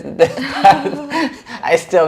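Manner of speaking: amused laugh at the answer. Real, not acted. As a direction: break into real laughter mid-word. Laughing at whimsical.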